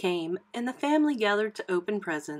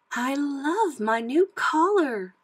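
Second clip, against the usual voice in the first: high, slow, louder and delighted; delighted Lady; 7 semitones higher, 5 dB louder, slower than usual. A narrator's reading. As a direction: gush slowly in a high voice. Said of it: "Lady said".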